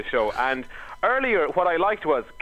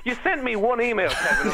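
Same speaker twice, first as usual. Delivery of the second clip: normal talking voice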